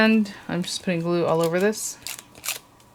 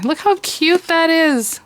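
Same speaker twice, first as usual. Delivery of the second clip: high, drawn-out squeal, louder; delighted squeal; 10 semitones higher, 4 dB louder, slower than usual. Real, not acted. Crafter shows viewers her finished ornament, squealing with delight. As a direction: squeal over how cute it turned out. Thrilled by the result.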